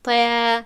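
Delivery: طا loud, alif thin and light; thin wrong alif. Shown as a demonstration. Teacher showing the mistaken thin reading.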